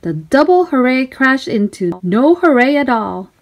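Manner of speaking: usual speaking voice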